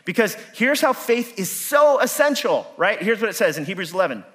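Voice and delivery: rising preacher's fervour; preaching. Acted. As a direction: preach it out to the room, full force. Preaching to congregation.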